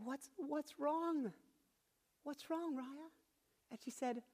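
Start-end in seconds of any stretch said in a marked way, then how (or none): 0.0-3.1 high, soft, drawn-out parental concern; gentle concern. Retold or imitated. Father asks his tearful little daughter, gentle concern. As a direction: softly coo the question to a child.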